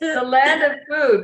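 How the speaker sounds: loud high Italian chef voice; hammy boisterous chef. Pretend. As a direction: boom it like a jolly Italian chef. Hammy Italian after chef kiss.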